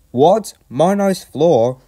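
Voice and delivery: high, loud, indignant grumble; put-out guard objecting; grumbling. A narrator's reading. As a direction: protest it slowly, scandalised. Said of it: "grumbled the guard".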